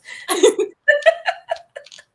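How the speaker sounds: rising burst of high laughter; high delighted laugh; high giggle. Real, not acted. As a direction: break into squealing laughter. Laughing at friend's reaction.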